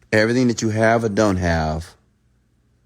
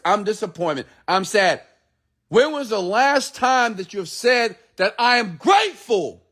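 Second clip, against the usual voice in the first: high, fervent motivational preaching; full-force pulpit voice; 9 semitones higher than usual. Acted. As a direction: preach it to the crowd with fire. Preaches to his online audience.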